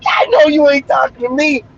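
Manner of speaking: sassy snap back. Retold or imitated. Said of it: "you snap back like a".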